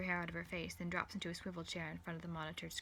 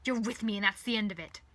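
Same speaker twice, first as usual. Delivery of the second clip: loud, domineering anger; loud growled command; 3 semitones higher, 11 dB louder than usual. A narrator's reading. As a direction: lay down the law forcefully. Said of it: "he growls".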